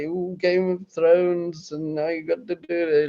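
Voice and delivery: lightly hammed-up ooh; mock excitement. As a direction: coo over it in mock delight. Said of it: "And it's like".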